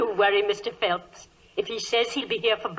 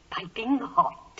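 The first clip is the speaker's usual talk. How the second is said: whisper; 4 dB quieter, slower than usual. Acted. Landlady serving breakfast.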